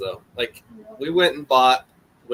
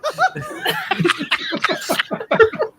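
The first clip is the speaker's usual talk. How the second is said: long, high-pitched laughing fit; helpless laughter. Real, not acted. Cracking up as friends roast his feet, helpless high laughter.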